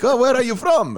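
high-pitched imitation of a Russian-accented woman. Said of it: "like, that type of accent".

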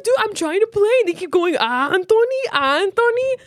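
put-on high, pleading little kid complaint; whiny kid voice. Kid complaining to mom about siblings, high whiny voice. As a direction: whine it up high like a wronged kid. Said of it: "And he's going".

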